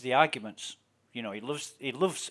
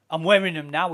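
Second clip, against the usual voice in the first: loud, cocky retort; smug loud boast; 5 semitones higher, 10 dB louder than usual. Retold or imitated. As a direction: crow it at him proudly. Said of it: "he said".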